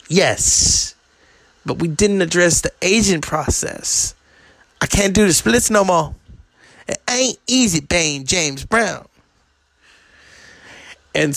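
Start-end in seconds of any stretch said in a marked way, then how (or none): 0.1-9.1 higher, complaining [name]; mock [name] pleading; a hint of [name]. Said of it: "he's just like".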